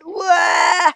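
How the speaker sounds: high, loud crying wah; boy wailing when mother catches him; child's wail. A narrator's reading. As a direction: bawl like a caught little boy. Boy caught, wails.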